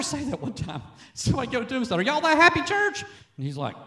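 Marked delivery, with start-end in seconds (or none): 2.0-3.0 high imitated question, rising at the end; put-on inquisitive voice, climbing high. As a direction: pitch it way up, quoting the question. Retelling a pastor's question to another.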